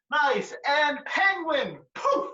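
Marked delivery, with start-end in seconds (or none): 1.9-2.3 very high squeaky poof; silly clown. Acted. Wizard casting a spell.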